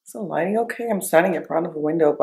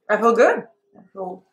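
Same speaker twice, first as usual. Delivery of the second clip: talking with numb swollen lips; words blurred by numb lips. Real, not acted. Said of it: "It just feels weird to talk".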